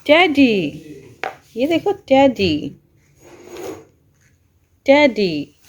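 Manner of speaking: slow, stretched, loud excited cooing. Real, not acted. Unwrapping teddy for baby.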